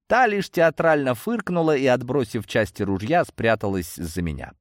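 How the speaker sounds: usual speaking voice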